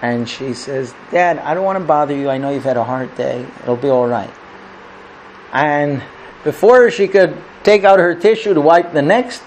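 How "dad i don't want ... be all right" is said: low, soft, acted hurt; subdued, tearful daughter. Crying daughter tells Dad not to bother, quietly sad. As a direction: sink quietly into brave self-pity. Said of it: "she continues crying".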